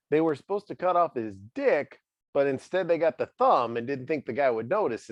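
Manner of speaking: usual speaking voice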